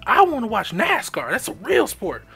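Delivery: high, loud, angry mock rant; old cellmate refusing the football game, loud mock rant. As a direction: bark it like a bigoted old man. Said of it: "he'd be like".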